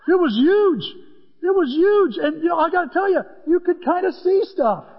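high and loud, full of wonder; bursting with awe at the moon; excited. Real, not acted. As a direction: marvel at how huge it was. Marveling at the huge moon.

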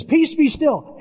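"Peace, be still" rung out high and strong, falling on "Peace"; Jesus commanding the storm. Retold or imitated. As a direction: command the sea with authority, "Peace" high and loud. But he says.

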